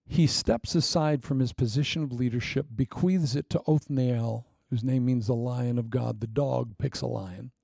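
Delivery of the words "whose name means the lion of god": ordinary talk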